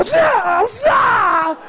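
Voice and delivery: high, shouted kung-fu yells; mock ninja battle cries. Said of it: "era como una ninja".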